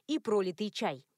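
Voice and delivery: usual speaking voice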